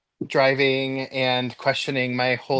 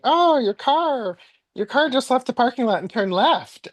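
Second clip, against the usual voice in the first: put-on high singsong kid's voice; imitated child; 10 semitones higher than usual. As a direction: imitate a kid reporting innocently, pitched up. Voices coworker's young son.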